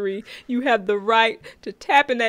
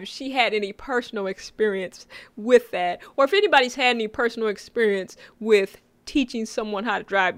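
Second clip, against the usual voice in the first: normal talking voice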